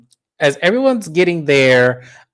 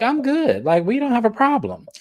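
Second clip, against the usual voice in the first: high, airy mock of a breezy I'm good; lightly voiced carefree reality star; breezy denial; 9 semitones higher than usual. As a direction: toss it off lightly in a much higher voice. Retelling Wendy's breezy denial.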